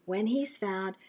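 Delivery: lively storybook reading; reading to children. Acted. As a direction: read it to little kids. Reading a picture book.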